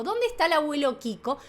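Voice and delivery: usual speaking voice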